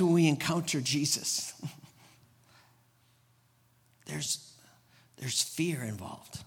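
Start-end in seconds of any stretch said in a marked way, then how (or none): 4.1-6.4 hushed, breathless whisper; whispered aside about fear. Real, not acted.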